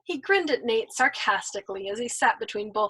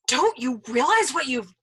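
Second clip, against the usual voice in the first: louder vehement scold; 3 semitones higher, 5 dB louder than usual. Acted. Nately scolding the old man, vehement. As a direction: scold him hard. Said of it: "he scolded vehemently".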